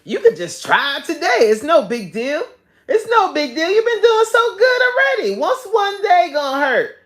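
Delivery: high, coaxing tempter voice; sugar devil voice. Retold or imitated. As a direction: sweet-talk like the devil on your shoulder. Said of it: "Talking about".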